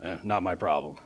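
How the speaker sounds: very low, soft shrug; dismissive indifference. Acted. Preacher voicing previous British governors ignoring sati, dismissive indifference. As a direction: toss it off with no concern.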